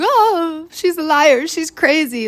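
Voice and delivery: high-pitched mock boyfriend voice; hammed-up, high accusing whine; mocking imitation. Mocks lying boyfriend's accusation.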